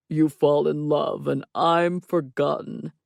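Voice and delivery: slow, mock-tragic lament; overacted heartbreak in a put-on voice; drama king. A narrator's reading. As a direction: draw out the woe theatrically. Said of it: "Stop being a drama king".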